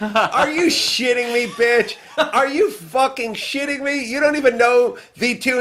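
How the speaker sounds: high, incredulous retold rant; exasperated outburst. Host to clueless guest failing his quiz, high exasperated outburst.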